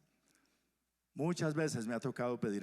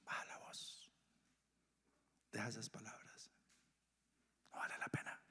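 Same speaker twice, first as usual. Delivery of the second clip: breathy unvoiced calming whisper; soothing whisper; 3 semitones lower, 11 dB quieter than usual. Preacher whispers calm to an angry heart, hushed and soothing. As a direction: hush someone down gently.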